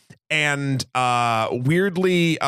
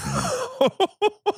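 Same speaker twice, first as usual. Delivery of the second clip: high burst of laughter. Real, not acted. Breaks into laughter.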